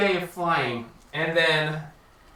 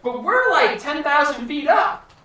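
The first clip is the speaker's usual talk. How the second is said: higher, louder baffled voice; astonished disbelief; 9 semitones higher, 5 dB louder than usual. Retold or imitated. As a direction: blurt it out in shock. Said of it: "you're like".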